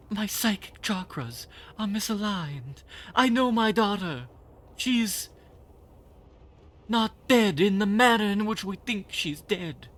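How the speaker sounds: high, loud, dramatic eccentric lady; conspiracy theorist woman. Acted. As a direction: play her as a wild-eyed mystic. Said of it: "How do I do conspiracy theorist woman?".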